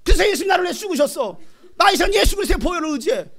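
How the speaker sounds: high, loud angry tirade; hammed-up, brazen yelling back; defiant shout. Preacher showing how to rebuke demons, defiant shout.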